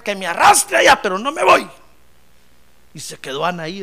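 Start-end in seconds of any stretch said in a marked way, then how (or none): 0.0-1.7 loud, high, stubborn refusal; defiant outburst. Acted. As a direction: dig your heels in loudly. Ana se niega a irse. (desafío terco en voz alta)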